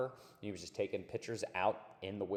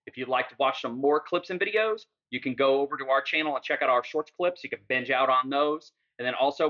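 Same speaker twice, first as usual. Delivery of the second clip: louder, upbeat sign-off read; outro plug; 4 semitones higher, 9 dB louder than usual. Acted. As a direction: pitch the channel to the viewers, loud and bright. Loud channel outro plug.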